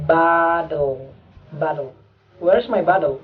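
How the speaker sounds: bottle said American, baddle, T as D; American bottle with an open A and a flapped T; American pronunciation. Shown as a demonstration. Teacher demonstrating American bottle, T as D. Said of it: "Maka bacanya".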